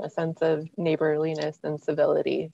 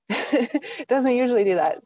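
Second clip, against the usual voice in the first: laughing; 7 semitones higher, 5 dB louder than usual. Real, not acted. Laughing at own blank mind.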